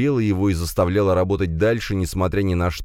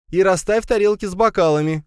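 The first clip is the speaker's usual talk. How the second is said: high, raised motherly call; shouting after someone; 4 dB louder than usual. A narrator's reading. Mother shouts after son leaving kitchen, calling out. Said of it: "крикнула вдогонку мать".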